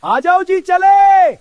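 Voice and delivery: high, drawn-out call. Acted. Grandfather calls the family to leave, calling out high. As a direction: holler for everyone to set off.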